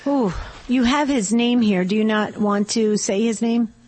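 normal talking voice

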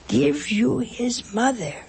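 normal talking voice

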